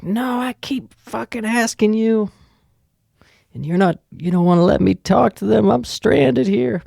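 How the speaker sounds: put-on high-pitched, upset drunken complaint; Sheila. Stranded woman demanding the phone from the clerk, high and whiny. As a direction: protest in a high voice, dragging out the end. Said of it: "I'm literally Sheila when I'm drunk".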